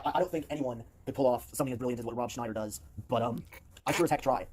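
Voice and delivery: ordinary talk, nothing marked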